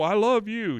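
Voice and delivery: loud, high pleading; acted panicked reassurance; desperate protest. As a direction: plead like a husband in trouble. Acting out Hank's panicked protest.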